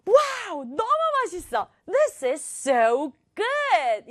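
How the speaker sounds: high-pitched wowed first bite; delighted exclamation. Acted. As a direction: exclaim in delight, drawing out the praise. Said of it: "감탄을 했어요".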